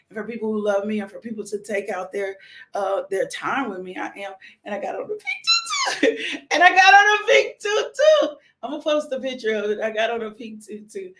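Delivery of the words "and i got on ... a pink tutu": high, loud squeal with laughter; super excited. Real, not acted. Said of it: "I'm super excited about my pink tutu".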